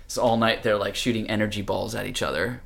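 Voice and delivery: plain reading voice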